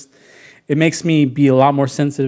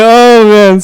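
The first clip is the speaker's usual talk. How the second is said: louder, higher groaning dread; dismayed kid; 7 semitones higher, 13 dB louder than usual. Retold or imitated. Boy dreads his mom pulling stitches, loud and high.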